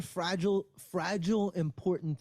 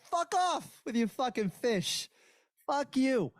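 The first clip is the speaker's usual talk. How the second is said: higher-pitched, hammed-up brush-off; indignant mock refusal, pitched up; mock angry dismissal; 8 semitones higher than usual. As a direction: play a defensive local telling someone off. Acted refusal by defensive Boston local.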